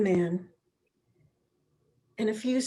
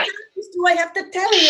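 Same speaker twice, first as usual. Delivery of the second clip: loud, much higher, exasperated Italian husband yelling; angry shout; 8 semitones higher, 4 dB louder, faster than usual. Acted. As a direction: bellow at her like a fed-up husband. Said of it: "he began to shout".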